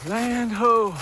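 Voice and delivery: high, loud lookout call repeated; shouting from crow's nest. Pretend. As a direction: call it out again like a sailor.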